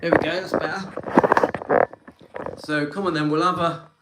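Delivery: puffing after the walk, out of breath. Real, not acted. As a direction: speak between short breaths. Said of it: "I'm a bit out of breath".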